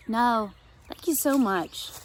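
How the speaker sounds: slow, heartfelt thank-you; grateful thanks. Acted. Josie slowly and gratefully thanks Vanessa for the sandwich. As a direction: thank her slowly, touched.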